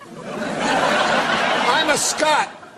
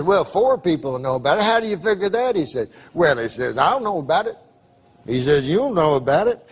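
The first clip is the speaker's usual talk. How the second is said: Southern drawl; 4 semitones lower than usual. Retold or imitated. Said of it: "I can tell by the accent".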